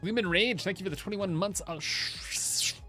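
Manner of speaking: ordinary talk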